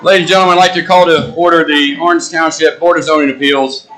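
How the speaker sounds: loud formal opening announcement; formal call to order. Real, not acted. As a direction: address the room loudly and formally. Chair calls meeting to order.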